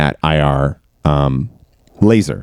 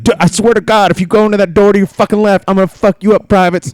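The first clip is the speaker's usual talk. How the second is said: drill sergeant yelling; 12 semitones higher, faster than usual. Retold or imitated. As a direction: scream orders at the privates. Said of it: "He's like".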